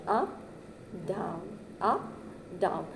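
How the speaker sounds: Up rising, down falling, up rising, down falling; rising and falling tune. Shown as a demonstration. Teacher showing students the rise and fall of intonation. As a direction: sing the tune up, down, up, down. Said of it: "the falling and raising intonation".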